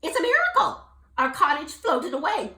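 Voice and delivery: high, loud joyful relief; relieved mother toad. Acted. Gertie hugging Jubal, overjoyed. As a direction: gush with relief.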